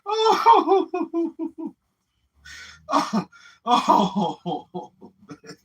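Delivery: high wailing ohs winding down; spent, almost crying from laughing; laughing to tears. Real, not acted. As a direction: moan weakly through laughter. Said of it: "I got tears in my eyes".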